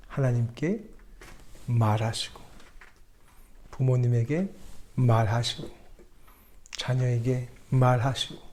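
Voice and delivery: low, soft blessing; solemn benediction. Acted. Pastor closes the sermon with a benediction, soft and solemn. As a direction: bless the church quietly and solemnly.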